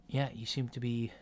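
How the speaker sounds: normal talking voice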